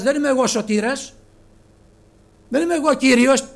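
high, loud, ringing declaration; loud quoted denial. Acted. Preacher voicing John loudly to the congregation, loud declaration. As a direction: cry it out as the humble prophet. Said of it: "λέγει ο Χριστός".

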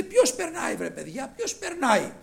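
high, loud calling question; calling out loudly. Retold or imitated. Blind Bartimaeus asking who is passing, calling out loudly. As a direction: shout it out to passers-by. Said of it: "φώναζε δυνατά όταν, λέει".

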